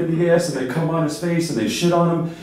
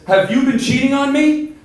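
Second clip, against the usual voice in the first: much higher, louder accusation; accusing husband; 9 semitones higher, 5 dB louder than usual. Acted. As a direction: demand it of your wife, outraged.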